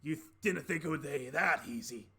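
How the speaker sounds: taunting Dragonborn villain voice; smirking Dragonborn. Acted. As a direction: gloat at a foe as the Dragonborn. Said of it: "the Dragonborn smirks".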